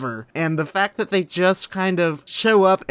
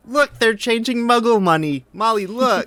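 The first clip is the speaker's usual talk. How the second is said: louder, higher voice of an excited dad; excited Arthur; 7 semitones higher, 5 dB louder than usual. Retold or imitated. Arthur pointing out Muggle money to Molly, excited. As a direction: point it out to your wife, thrilled.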